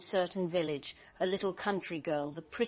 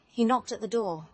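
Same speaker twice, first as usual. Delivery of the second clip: ordinary delivery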